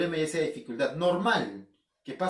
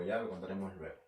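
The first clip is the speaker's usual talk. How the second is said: much lower and softer, muttered to himself; a trailing throwaway repeat; quiet aside; 10 semitones lower, 11 dB quieter, faster than usual. Real, not acted. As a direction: drop the voice as if talking to yourself. Repeats the promise, then fades.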